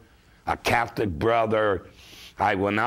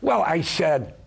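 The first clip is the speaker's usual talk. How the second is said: ordinary delivery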